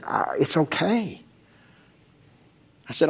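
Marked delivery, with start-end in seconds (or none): 0.0-1.2 lightly voiced soft, slow reassurance; wife's gentle reassurance. Wife calming her husband on the phone, soft and slow. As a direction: soothe him slowly, a hint of the wife. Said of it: "And she said".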